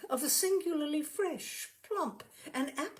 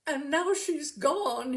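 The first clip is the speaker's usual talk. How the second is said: slow, louder, voice about to break; a man bursting into tears over his lost pig; 5 semitones higher, 6 dB louder, slower than usual. Acted. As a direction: let it crack as the tears come. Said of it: "and with that he burst into tears".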